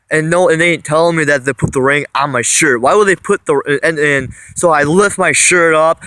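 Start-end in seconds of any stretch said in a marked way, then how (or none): none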